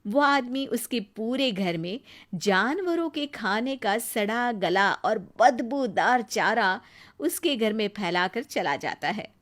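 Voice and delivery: usual speaking voice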